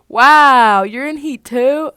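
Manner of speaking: loud, high laughing taunt; mock your brother through laughter; loud, high laughing jab; laughing tease.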